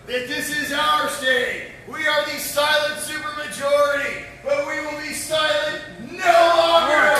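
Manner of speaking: slow, loud building finale; rousing climax. Real, not acted. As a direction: build to a roaring finish. Climax of rally speech.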